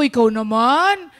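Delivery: mock scolding, ribbing a mother; teasing. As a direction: tease her into going along. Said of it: "Binibiro namin siya".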